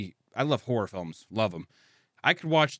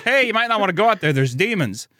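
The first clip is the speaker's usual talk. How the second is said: quick, raised casual warning; warning villager; 6 semitones higher, 6 dB louder than usual. Retold or imitated. Villager warning boy about demons, in mock concern. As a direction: call a comic warning out a window.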